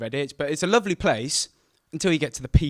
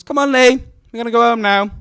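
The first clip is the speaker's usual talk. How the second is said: much higher, much louder call; mum calling out; 10 semitones higher, 12 dB louder than usual. Retold or imitated. Mum calling her son Lee home from the park, loud and high.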